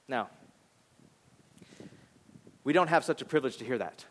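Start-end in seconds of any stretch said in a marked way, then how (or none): none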